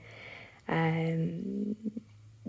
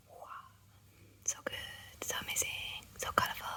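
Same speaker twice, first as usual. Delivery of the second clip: hushed, admiring whisper; awed whisper; 13 dB quieter, much faster than usual. Real, not acted. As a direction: breathe it in awe.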